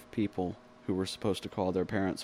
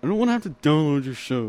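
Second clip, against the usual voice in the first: loud mock whine; 6 semitones higher, 8 dB louder than usual.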